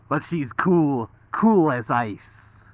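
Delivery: slow cold villain voice, lightly done; Mister Freeze voice. Acted. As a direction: drawl it out like an icy villain. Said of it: "It's a Mister Freeze from Batman".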